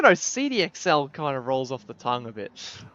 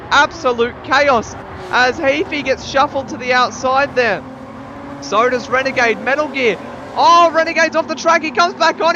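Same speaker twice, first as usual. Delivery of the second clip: excited, higher-pitched race commentary; live play-by-play; 10 semitones higher, 10 dB louder than usual. Real, not acted. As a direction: call the race live, surging at each incident.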